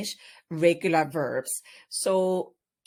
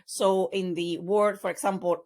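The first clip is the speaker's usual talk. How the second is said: ordinary talk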